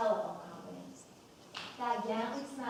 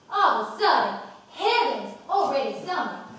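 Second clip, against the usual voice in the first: much louder, breathless burst of delight; gushing exclamation; 3 semitones higher, 11 dB louder than usual. Acted. Mother to the guest, gushing loudly about sudden summer. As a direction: throw up your hands at the sudden summer.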